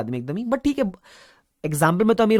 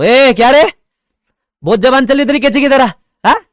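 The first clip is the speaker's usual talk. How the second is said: mock scolding; 8 semitones higher, 12 dB louder than usual. Teacher mock-scolding a student.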